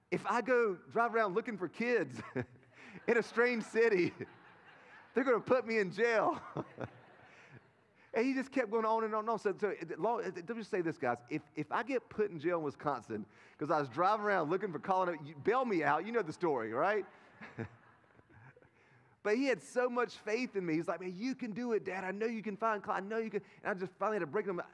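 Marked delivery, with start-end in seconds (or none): 0.1-6.9 laughing through the words, higher and louder; cracking up while telling it. Real, not acted. Laughing while telling jail fear. 21.0-23.4 lightly voiced eager kid, higher; a hint of a pleading boy; mock child voice coaxing dad; eager son.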